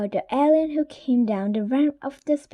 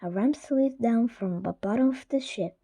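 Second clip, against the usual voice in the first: usual speaking voice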